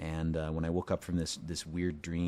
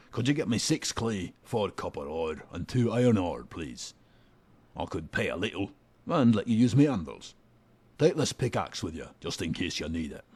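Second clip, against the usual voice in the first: gruff Scottish smith. Acted. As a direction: growl it out like a crude dwarf smith. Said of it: "vary it up for him".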